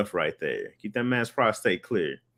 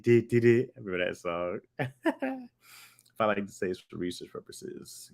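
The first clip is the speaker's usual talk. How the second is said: usual speaking voice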